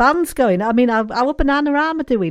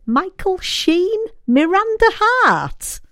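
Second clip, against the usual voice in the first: much higher and slower, names drawn out with glee; excited rising voice; 10 semitones higher, slower than usual. Real, not acted. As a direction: let excitement lift the pitch right up. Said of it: "My voice is going up with excitement".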